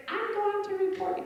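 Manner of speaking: high prissy threat; busybody voice. Retold or imitated. Comic mimicking a shopper threatening the parent, prissy.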